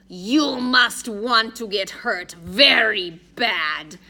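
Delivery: very slow, loud threat; slow, forceful villain voice; menacing Russian thug. A narrator's reading. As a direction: lean on each word like a heavy. Russian thug grips Addison's throat.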